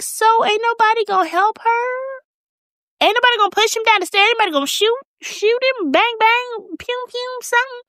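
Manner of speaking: high, indignant rant with sound effects; exasperated mock outrage. Viewer scolding the servants who ignored the attack, high indignant outrage. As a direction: squeak it up high like you can't believe nobody helped.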